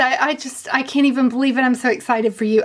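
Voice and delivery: normal talking voice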